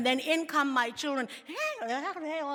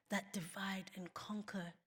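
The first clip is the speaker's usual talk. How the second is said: that divide and conquer whispered, much softer; 4 semitones lower, 13 dB quieter than usual. Shown as a demonstration. Speaker to her audience, whispering the warning.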